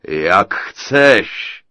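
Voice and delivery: loud, mocking giant chuckle in the words; chuckling giant. A narrator's reading. The giant brushing off the princess, chuckling. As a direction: laugh it off as the ogre. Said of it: "zachechtal se obr".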